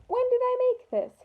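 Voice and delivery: high, bewildered question; the baker, baffled by the batter, asks himself in a high puzzled voice; puzzled wondering. A narrator's reading. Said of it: "he wondered".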